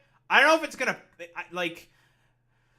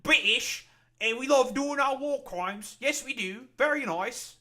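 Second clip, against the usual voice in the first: high, loud mock British accent; hammed-up British accent, pitched way up; bad British accent; 10 semitones higher, 6 dB louder than usual. Streamer mocking the British over war crimes, bad British accent. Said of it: "probably a dog shit fucking accent".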